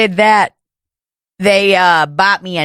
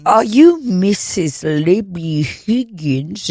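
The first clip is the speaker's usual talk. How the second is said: put-on slow, affected high-class accent; slower than usual. Would-be process server asking for Libby Higgins, fake fancy accent. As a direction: overdo a fancy Manhattan-font accent, word by word. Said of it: "you're doing a fucking accent".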